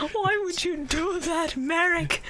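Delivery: high, drawn-out tearful whine; mock crying. Yami on the ground after Merrick's insult, mock crying. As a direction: wail it like a hammed-up sob. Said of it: "falls on the ground crying".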